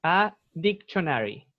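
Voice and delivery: "A dictionary" slowly, middle sounded as cho; CH sound demo. Shown as a demonstration. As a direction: model "A dictionary" as dic-cho-na-ry. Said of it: "Sí, C H".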